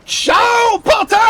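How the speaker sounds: high, very loud taunting yell; mocking shout. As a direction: yell a gleeful farewell. Mocking shouted farewell to victim.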